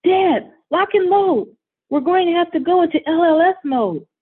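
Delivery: rally the troops at full voice; shouting. Acted. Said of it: "Derek shouts".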